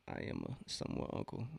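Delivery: ordinary talk, nothing marked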